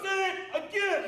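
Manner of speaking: barked orders at full volume; drill sergeant shouting. Real, not acted. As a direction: yell it like a drill instructor. Drill instructor yelling.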